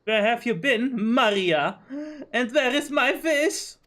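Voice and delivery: shrill lady scolding. Acted. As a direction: scold the girl for losing it. Voices the vase owner.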